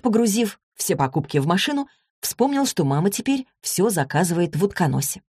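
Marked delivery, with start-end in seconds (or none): none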